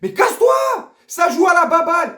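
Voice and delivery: bursting out in exasperation at the team; retold furious shout. Fan yelling at the coach in his head, furious shout. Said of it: "je me dis".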